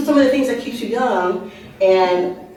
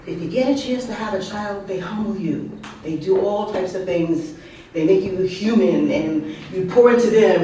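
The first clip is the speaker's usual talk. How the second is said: ordinary talk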